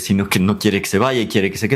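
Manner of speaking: usual speaking voice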